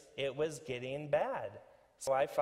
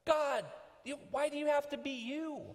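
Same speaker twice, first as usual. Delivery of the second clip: high-pitched exasperated Jonah; mad at God; 13 semitones higher than usual. Acted. Jonah railing at God for being God, mock anger. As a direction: complain up at God in a much higher voice. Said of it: "Jonah's mad at God".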